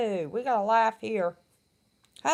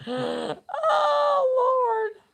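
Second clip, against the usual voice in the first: laughing; 14 semitones higher than usual. Real, not acted. Cracking up at pickle joke.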